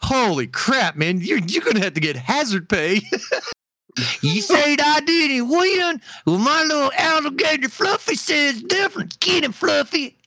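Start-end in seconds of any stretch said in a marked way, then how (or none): 4.2-10.2 sic the gator on the ref; Florida Man contestant. Pretend.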